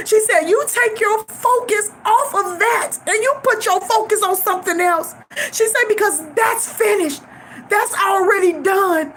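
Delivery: tearful. Real, not acted. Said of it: "always have the ability to make me cry".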